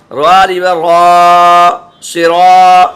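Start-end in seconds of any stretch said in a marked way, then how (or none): 0.1-1.7 را الف زبر را with the long a of را stretched very slowly; long madd on را; drawn-out vowel. Shown as a demonstration. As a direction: stretch the alif in را, slow and loud. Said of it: "تھوڑا کھینچ کے پڑھا جائے گا".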